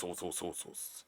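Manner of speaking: trailing off to an unvoiced whisper; murmured under the breath. Real, not acted. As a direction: let it fade to a whisper.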